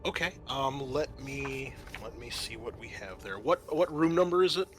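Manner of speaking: ordinary talk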